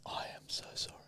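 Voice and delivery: hushed whispered apology. Acted. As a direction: whisper it, mock solemn.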